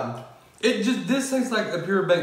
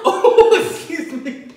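laugh, hiccup and burp at once; hiccup giggles; 13 semitones higher, 9 dB louder, much slower than usual. Real, not acted. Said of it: "a laugh, a hiccup, and a burp".

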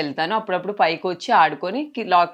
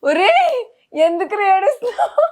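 very high, loud emotional cry; tearful wail; 8 dB louder than usual. Real, not acted. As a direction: wail the question at a crying little one. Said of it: "మీరు ఏడుస్తున్నారు కాబట్టి".

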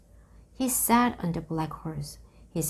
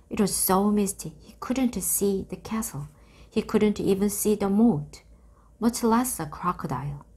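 usual speaking voice

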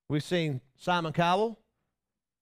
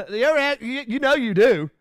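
ordinary delivery

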